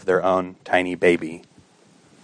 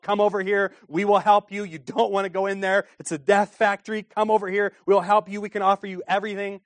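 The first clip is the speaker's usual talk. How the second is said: much higher, gentle urgent call; offering help; 10 semitones higher, 4 dB quieter than usual. Retold or imitated. Counselor calling to a woman outside the clinic, gently urgent. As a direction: reach out kindly across the alley. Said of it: "but just offering help".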